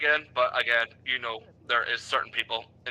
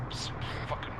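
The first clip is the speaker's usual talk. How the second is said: whispered curse; 9 dB quieter than usual. Real, not acted. Muttering at own radio mistake.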